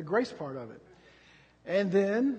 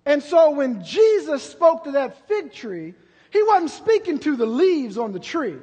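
high, loud, slow climactic shouting; preaching at full force; 7 semitones higher, 10 dB louder than usual. Acted. Preacher climaxing the fig tree lesson with a shout. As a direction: drive it home to the congregation.

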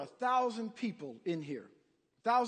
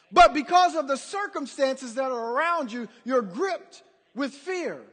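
raised, louder pulpit delivery; impassioned preaching; 6 semitones higher, 6 dB louder than usual. Real, not acted. Preacher urging the fearful congregation, forceful and impassioned. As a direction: drive it home, stretching the key words.

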